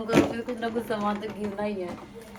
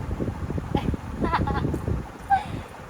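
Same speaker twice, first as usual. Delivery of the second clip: breathless delighted laugh; airy laugh; 6 semitones higher than usual. Real, not acted. Mother laughing at her baby breathlessly. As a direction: let out a breathy giggle.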